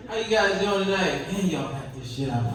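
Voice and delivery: ordinary talk, nothing marked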